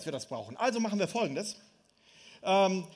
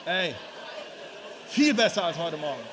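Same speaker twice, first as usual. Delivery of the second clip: called out louder to the crowd; loud teasing demo; 4 semitones lower than usual. Shown as a demonstration. Preacher shows the congregation how to tease the neighbour, loud. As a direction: say it out to the room like you mean it. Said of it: "Sag das mal bitte deinen Nachbarn".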